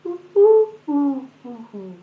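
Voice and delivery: high cooing ooh falling away; pigeon coo rhythm. Shown as a demonstration. To her audience, tapping out the pigeon's rhythm: high coo. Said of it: "listen again to the rhythm".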